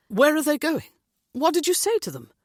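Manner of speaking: shocked demand; stunned question. A narrator's reading. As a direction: disbelief as officers leave. Stunned James questions Nick.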